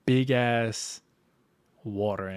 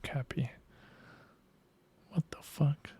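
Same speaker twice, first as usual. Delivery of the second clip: shocked whisper; 14 dB quieter than usual. Real, not acted. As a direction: breathe it out in disbelief. Stunned on seeing the okapi.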